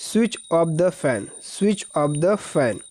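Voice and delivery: very slow, word by word; slow dictation. Shown as a demonstration. Teacher dictating the English line to students, very slow. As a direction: spell it out slowly for the class. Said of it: "teacher reading the sentence slowly".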